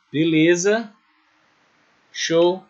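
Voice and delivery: ordinary delivery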